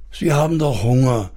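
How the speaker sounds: ordinary delivery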